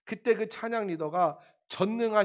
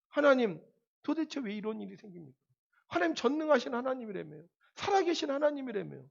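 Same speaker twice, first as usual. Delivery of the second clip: mild mock complaint; 6 semitones higher than usual. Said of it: "원망과 분노를 쏟아놓기".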